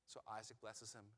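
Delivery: normal talking voice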